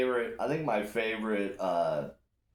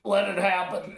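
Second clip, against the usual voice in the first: retold shout; 11 semitones higher, 7 dB louder than usual. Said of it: "And I'm like".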